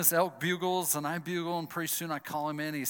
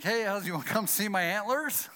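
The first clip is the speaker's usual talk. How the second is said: higher, louder, bright offer; cheerful; 7 semitones higher, 5 dB louder than usual. Retold or imitated. Calling the friend who left him, cheerfully inviting. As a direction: sound happy, no grudge at all.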